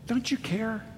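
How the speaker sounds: "Don't you care?" asked high and soft, rising; pleading question. Acted. Repeating the disciples' cry.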